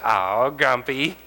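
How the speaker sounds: slow, drawn-out teasing oh grumpy. Retold or imitated. To the tired wife, a slow teasing grumpy. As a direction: tease the tired mother. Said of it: "I literally said".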